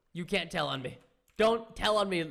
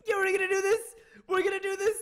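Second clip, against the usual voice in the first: hyped up; 11 semitones higher, 5 dB louder than usual. Real, not acted. Psyching himself up to enter.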